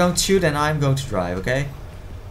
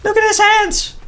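very high, louder amused squeal; laughing; 6 dB louder than usual. Real, not acted. Laughing at odd character.

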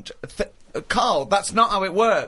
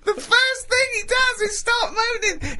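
very high, slow squeal of disbelief; helpless incredulity; slower than usual. Real, not acted. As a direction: wail it out, nearly in hysterics. Helpless incredulous outburst at diary's opening.

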